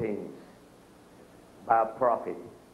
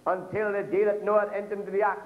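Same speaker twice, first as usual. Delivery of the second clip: higher, proclaiming preacher's read; scripture reading; 6 semitones higher than usual. Acted. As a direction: lift the verse up to the church. Reads Luke in preaching voice.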